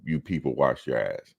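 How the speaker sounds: ordinary talk, nothing marked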